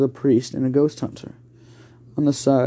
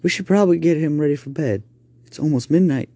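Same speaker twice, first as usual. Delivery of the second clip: plain reading voice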